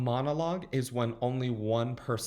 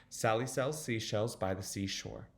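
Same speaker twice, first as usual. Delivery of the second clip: flowing, quicker tongue twister; a little faster; 3 dB quieter than usual. Shown as a demonstration. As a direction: pick up the speed, keep the words connected. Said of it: "get a little faster".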